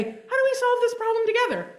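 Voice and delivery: high, sing-song mock peacemaker; mock friendly overture. Speaker mimics a naive approach to a neighbor, mock-chirpy.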